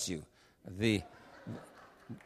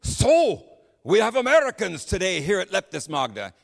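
loud, high tour-guide announcement; 5 semitones higher, 5 dB louder than usual. Retold or imitated. Tour guide greets the American couple at Leptis Magna, in a loud voice. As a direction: open on a booming, raised "So" and proclaim it. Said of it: "in a loud voice".

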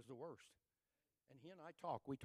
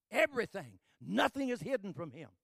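loud, high preacher's cry; preaching shout; 7 semitones higher, 15 dB louder than usual. Real, not acted. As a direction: shout it out to the congregation.

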